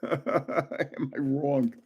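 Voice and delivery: soft, chuckled question; laughing. Real, not acted. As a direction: chuckle through the words, slowly. Laughing at own guess.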